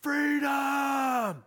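long, high battle yell. Acted. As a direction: bellow it as a last dying cry of defiance. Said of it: "he yells out".

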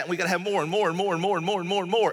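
fast, rhythmic run of repeats; rapid piling up. Acted. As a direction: rattle off the list in a rolling singsong. Acting out endless wanting.

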